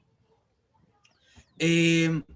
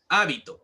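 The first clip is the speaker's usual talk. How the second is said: "hábito" stressed on the first syllable, HÁ-bi-to; teacher's demo of "hábito", stressed HÁ-bi-to; 3 semitones lower, 3 dB louder than usual. Shown as a demonstration. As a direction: hit the "há" in "hábito". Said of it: "dependiendo dónde nosotros estamos acentuando esa palabra".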